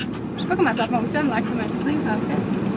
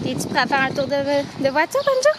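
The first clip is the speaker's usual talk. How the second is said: talking to a dog; 3 semitones higher, 4 dB louder than usual. Real, not acted. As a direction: coax the dog, squeaky and eager. Inviting the dog for a ride. (parler au chien d'une voix aiguë et excitée)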